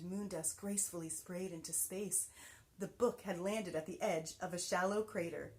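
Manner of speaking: plain reading voice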